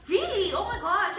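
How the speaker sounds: high, gushing fake amazement; feigned surprise. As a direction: act as if it's news to you. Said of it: "In my head, I was like".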